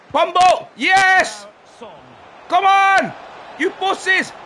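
loud, high whoops of joy; real excited shouting; goal celebration. Real, not acted. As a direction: erupt as the goal goes in. Team scores a goal.

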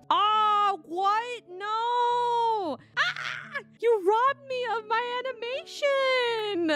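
robbed of the death scene, yelling; outraged shout. Real, not acted. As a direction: cry out in comic outrage. Death animation skipped, outraged.

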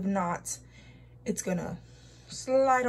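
hands patting cheeks; speaking through sheet mask; talking with hands on face. Real, not acted. Seen 1: hands press cheeks and sweep near mouth while speaking.

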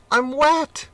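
very high, loud squeal; a shocked cat squealing. Acted. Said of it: "he squealed".

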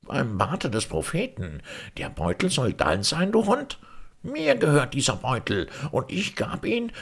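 high-pitched, exaggerated amazement; feigned astonishment. A narrator's reading. Grand Vizier claiming the purse from the merchant, feigned astonishment. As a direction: cry out in put-on indignant surprise. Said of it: "rief mit verstelltem Erstaunen".

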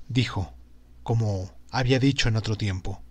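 usual speaking voice

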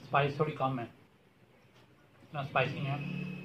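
talking between chews; speaking while eating food; mouth full, chewing. Real, not acted. Seen 0: Bite taken at -2s; jaw still working, lips pursed while talking.

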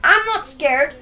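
usual speaking voice

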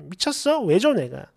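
plain reading voice

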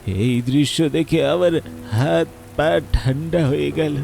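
usual speaking voice

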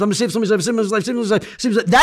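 high, rushed repetition; frantic mantra. Retold or imitated. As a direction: rattle it off like a desperate, looping mantra. Acts out frantic repeated OCD counter-phrase.

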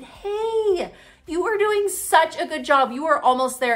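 high, encouraging preschool teacher voice; imitating her own high-pitched teacher pep talk; teacher to small child. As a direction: cheer on a little kid, pitched well up. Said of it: "the teacher hat kinda kicked in".

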